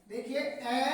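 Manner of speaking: ordinary delivery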